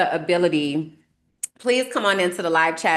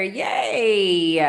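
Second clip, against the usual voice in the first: normal talking voice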